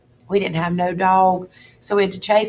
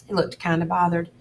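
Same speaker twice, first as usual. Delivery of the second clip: plain reading voice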